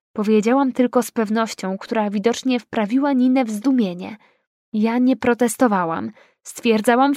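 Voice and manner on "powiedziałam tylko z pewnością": usual speaking voice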